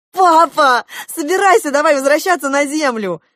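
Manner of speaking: high, loud plea to hurry; excited urging. A narrator's reading. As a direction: urge Dad to come along, loudly. Daughter excitedly urges father home. (взволнованно, громко торопя папу)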